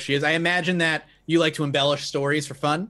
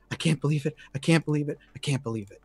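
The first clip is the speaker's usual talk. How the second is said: mild despair; 2 semitones lower, 4 dB quieter, faster than usual. Acted. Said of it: "crumples up against the wall ... she's like".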